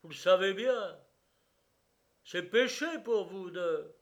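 insistent reproach. A narrator's reading. The protester reproaches the brigadier, loud and insistent. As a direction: press the accusation home loudly.

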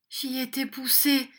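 high, faltering little voice; failing voice. A narrator's reading. Victim excusing himself to the schoolmaster, failing voice. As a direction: plead weakly, voice giving out. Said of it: "d'une voix défaillante".

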